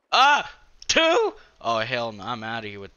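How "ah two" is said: very high, loud shocked cry; startled scream. Real, not acted. Gamer spotting a second enemy, startled scream. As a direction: shriek as another one shows up.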